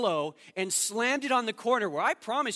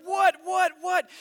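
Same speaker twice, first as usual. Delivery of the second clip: startled wife; 10 semitones higher, 8 dB louder, slower than usual. Retold or imitated.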